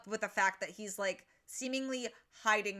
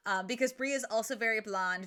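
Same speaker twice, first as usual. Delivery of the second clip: ordinary talk, nothing marked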